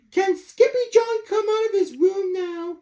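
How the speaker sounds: put-on very high small child's voice; little girl voice. Little sister asks mama, very high and small. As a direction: ask it sweetly, like a tiny girl.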